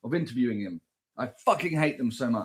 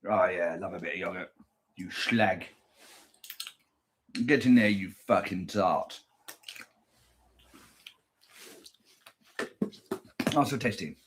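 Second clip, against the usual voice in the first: lower, soft leering voice; mock lewd; 7 semitones lower, 6 dB quieter than usual. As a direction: leer at the food like a seducer. Lewd talk to the yogurt.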